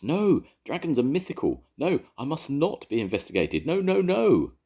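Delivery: high, loud frantic refusal; rising panic on each no; panicked crying out. A narrator's reading. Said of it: "cried Idris".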